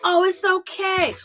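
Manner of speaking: high, slow mock soothing; cooing it's okay like a coddling friend. Mocking Kyle coddling Ramona, high and slow. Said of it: "Kyle is coddling her".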